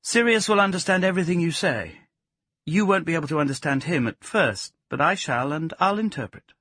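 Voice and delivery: high, assured woman's character voice; woman character, explaining. A narrator's reading. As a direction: pitch it up as she explains.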